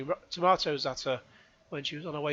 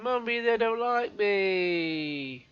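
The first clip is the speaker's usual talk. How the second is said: put-on high, slow whining kid; whiny child voice; 5 semitones higher, 3 dB louder, much slower than usual. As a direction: whine it like a crying schoolkid. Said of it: "a kid at school going".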